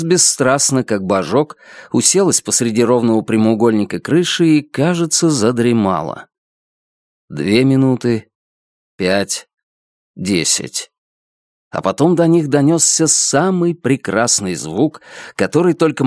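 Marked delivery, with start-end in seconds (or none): none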